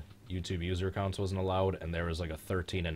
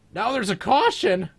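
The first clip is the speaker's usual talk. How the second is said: high, loud, drawn-out shout; excited race call; 13 dB louder than usual. Real, not acted. Calling a caution in the race.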